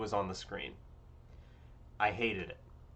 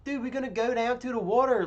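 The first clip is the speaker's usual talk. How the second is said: mock a Baltimore dude, pitched up; 11 semitones higher, 3 dB louder than usual.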